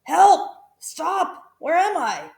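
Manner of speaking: dazed cries. A narrator's reading. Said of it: "cried the crocodile".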